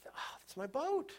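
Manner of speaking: high, soft cry of recognition, slowed; a child gasping at his lost boat; excited boy. Retold or imitated.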